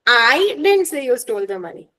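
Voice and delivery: I stretched and punched at the start; "I" stressed to shift the blame. Shown as a demonstration. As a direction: hit "I" hard, then run through the rest. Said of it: "Someone else said it".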